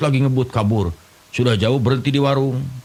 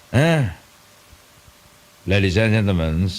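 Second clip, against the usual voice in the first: usual speaking voice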